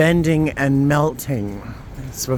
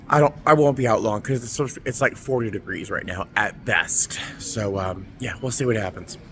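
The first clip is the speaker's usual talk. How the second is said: ordinary delivery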